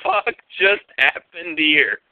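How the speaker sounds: slow, stunned retold outburst; baffled, drawn-out reaction; bewildered disbelief. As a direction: space the words out in bafflement. Bewildered after she storms off.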